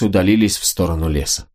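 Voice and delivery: usual speaking voice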